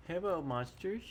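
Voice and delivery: ordinary talk, nothing marked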